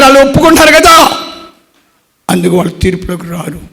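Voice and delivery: rising revival sermon; fervent preaching. Acted. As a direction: press the promise home with force. Preaching rises again.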